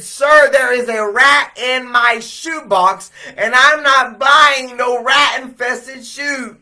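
loud, high and slow, every word pressed; indignant shout. Retold or imitated. Young man refusing rat-infested shoes, shouting at the clerk. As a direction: refuse firmly, outraged.

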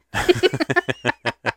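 bubbly throat laugh; weird gurgling laugh. Real, not acted. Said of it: "it sound like you have somebody inside you trying to get out".

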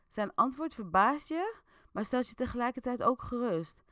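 usual speaking voice

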